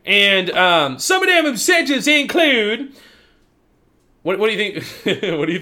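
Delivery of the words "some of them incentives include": high, drawn-out Southern drawl; put-on Southern accent. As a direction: stretch it like a Southern announcer. Resumes the praised Southern accent.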